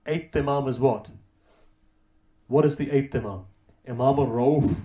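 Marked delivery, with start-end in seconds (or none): none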